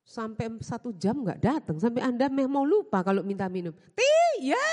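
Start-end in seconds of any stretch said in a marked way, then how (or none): 4.0-4.7 much higher, louder shout across the house; calling out to the maid. Retold or imitated. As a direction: yell for the maid, losing patience. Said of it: "gitu ya".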